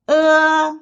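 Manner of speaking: "Uh" high, loud and level; first tone. Shown as a demonstration. Teacher leads repeat drill, high level uh. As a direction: hold "Uh" on one high note. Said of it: "The first tone is the highest".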